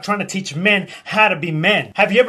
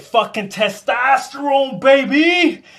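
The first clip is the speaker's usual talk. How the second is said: higher-pitched pumped-up cry; hyped-up; 6 semitones higher than usual. Acted. Man ranting about testosterone, hyped-up high cry. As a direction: build to a high, triumphant gym-bro finish.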